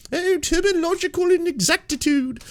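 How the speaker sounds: a very high, mocking British upper-class read; mock posh British. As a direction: lift it way up in a haughty British tone. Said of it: "This is so fucking British".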